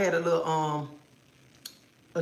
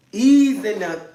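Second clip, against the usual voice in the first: louder, punchy ease in there; firm ease; 2 semitones higher, 7 dB louder, slower than usual. Shown as a demonstration. Warning viewers about the toy, firm louder ease. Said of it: "I told you, go slow".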